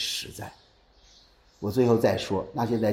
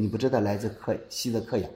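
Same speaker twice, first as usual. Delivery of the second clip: ordinary talk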